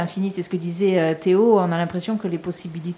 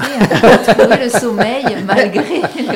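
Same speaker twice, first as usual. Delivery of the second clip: laughing; 3 semitones higher, 8 dB louder than usual. Real, not acted. Joke about snoring neighbour's sleep.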